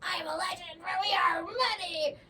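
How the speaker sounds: mock demonic voice; creepy demon voice. Shown as a demonstration. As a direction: make it sound possessed. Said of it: "say it in a different accent".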